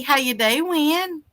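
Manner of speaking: normal talking voice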